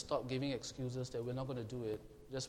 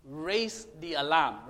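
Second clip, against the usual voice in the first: emphatic call; 9 dB louder, slower than usual. Acted. Fire warden instructions, emphatic.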